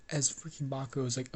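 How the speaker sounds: plain reading voice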